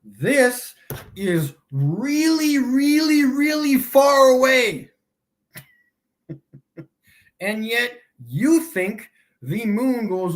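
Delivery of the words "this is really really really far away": each "really" higher and louder, "far" punched hardest; patronising build-up of stress on "really, really, really far"; building emphasis. Shown as a demonstration. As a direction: stack the "really"s, climbing to a loud "far away". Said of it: "One more time".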